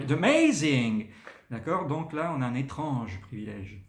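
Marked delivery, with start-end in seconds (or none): 0.0-1.1 slow, loud, gushing "It's amazing"; American enthusiasm. Retold or imitated. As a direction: gush like an American tourist. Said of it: "ils vont dire".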